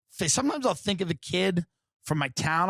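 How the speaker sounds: ordinary talk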